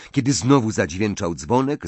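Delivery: plain reading voice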